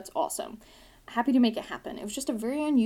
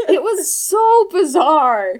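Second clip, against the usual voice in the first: loud, slow, high disbelief; incredulous; 6 semitones higher, 12 dB louder, much slower than usual. Real, not acted. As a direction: stretch it out in disbelief. Incredulous about tiny liquor store.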